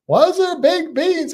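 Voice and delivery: put-on high, loud American tourist accent; default American accent. Mimicking cruise passengers at the buffet, exaggerated American accent. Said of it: "do my default American accent".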